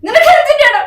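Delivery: loud, harsh scolding; angry shout. Acted. Husband shouting at wife for calling her family. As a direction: berate her at full voice.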